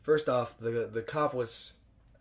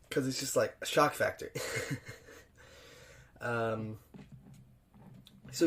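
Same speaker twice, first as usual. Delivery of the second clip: ordinary talk, nothing marked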